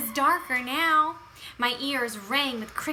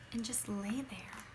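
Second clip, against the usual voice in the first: whisper; 2 semitones lower, 12 dB quieter than usual. A narrator's reading. Sad narration, whisper heard.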